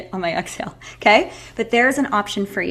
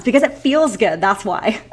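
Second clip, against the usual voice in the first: ordinary talk, nothing marked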